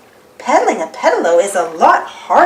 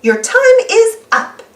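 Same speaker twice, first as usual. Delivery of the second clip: high, loud, slow announcement; calling out; 12 semitones higher, 6 dB louder, slower than usual. Acted. Miss Rabbit calls the boats back, high and loud. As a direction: call the boats in like a boat keeper. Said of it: "she calls".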